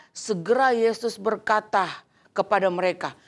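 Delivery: usual speaking voice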